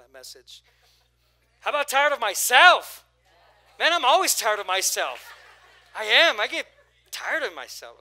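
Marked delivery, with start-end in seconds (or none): 1.6-8.0 loud, high, laughing preacher's confession; preaching at full force. Acted. Pastor to church, owning up loudly with a laugh. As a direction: shout it with a grin.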